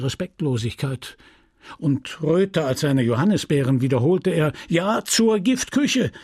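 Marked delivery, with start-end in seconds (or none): 4.7-6.1 raised, indignant scolding voice; the pharmacist fuming at his apprentice; a lightly voiced furious old apothecary; angry apothecary. Homais ranting at Justin.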